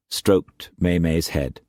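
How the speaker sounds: usual speaking voice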